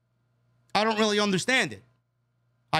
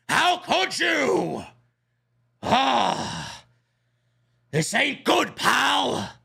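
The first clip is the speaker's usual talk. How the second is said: Vince McMahon impression; 5 semitones higher, much slower than usual. Vince McMahon yelling at his TV over the show. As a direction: bellow like an outraged old promoter. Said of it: "Somewhere Vince McMahon is watching".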